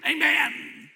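high, loud mock chorus of amens; booming, high-pitched repeated amens; imitated congregation amens. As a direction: call it out like a responding congregation. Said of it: "And the congregation would say".

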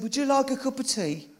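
high-pitched Brummie lilt; Brummie accent. Retold or imitated. Young girl offers the visitor tea, in a high Brummie accent. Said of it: "That's a Brummie accent".

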